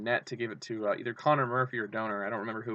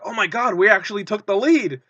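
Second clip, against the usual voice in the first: high, loud and amazed; can't believe we're ahead; thrilled disbelief; 8 semitones higher, 7 dB louder than usual. Retold or imitated. Coyotes fan reacting to taking the lead, thrilled. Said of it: "And I was like".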